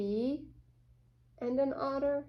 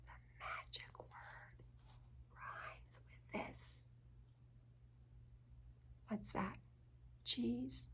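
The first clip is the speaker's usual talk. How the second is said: secret whisper; 15 dB quieter than usual. Acted. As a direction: whisper the hint conspiratorially. Reader hints secretly to children.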